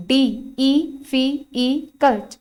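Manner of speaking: डी ई फ़ी ई कल्ट in slow pieces, echoing each vowel; vowel sounds isolated. Shown as a demonstration. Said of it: "एक से ज्यादा vowel sound हो गए".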